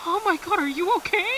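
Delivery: mock alarm at a wounded friend; shocked concern. Party shocked at Cooper's wound.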